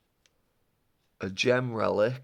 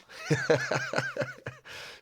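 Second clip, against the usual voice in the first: high laugh. Real, not acted. Laughs at own greedy joke.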